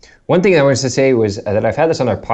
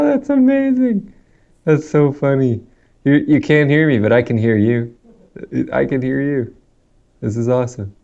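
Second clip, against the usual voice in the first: choppy call audio, breaking up; fading in through static; 3 semitones higher than usual. Real, not acted. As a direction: voice cutting in and out over a bad line. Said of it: "I've lost your audio again".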